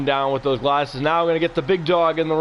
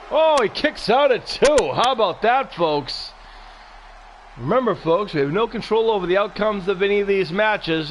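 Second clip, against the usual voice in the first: wrestling commentator; 5 semitones higher than usual. Acted. Live wrestling play-by-play calls.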